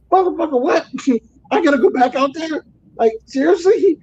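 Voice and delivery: high, incredulous outburst with a laugh; reliving the shock of being sent back out; outraged disbelief. Retold or imitated. Trucker told to go back into snow, high disbelief.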